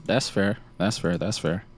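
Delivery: ordinary delivery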